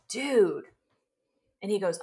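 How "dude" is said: loud, amazed exclamation; stunned by her son's energy, a loud "dude". Retold or imitated. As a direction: blurt it out in awe. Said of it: "And I said".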